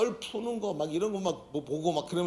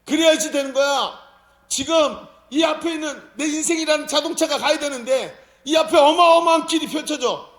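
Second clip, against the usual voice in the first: high, loud, fervent sermon voice; full-force preaching; 8 semitones higher, 7 dB louder than usual. Acted. (힘차게 외치는 설교)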